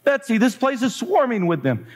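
alarmed complaint. Acted. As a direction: read it as distressed alarm.

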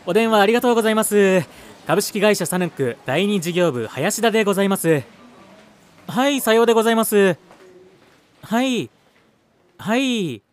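bright, fast phone sales voice; customer service voice. Acted. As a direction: chirp the scripted phone greeting. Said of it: "手順の決まった営業トークをさえずりながら".

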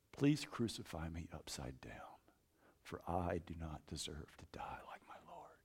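soft whispered plea; hushed plea. Retold or imitated. Peter asking soldiers to crucify him upside down, whispered. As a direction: whisper it humbly.

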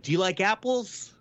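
retelling the famous bar scene, smug; mock taunt. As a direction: tease it like a gloating winner. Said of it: "he's like".

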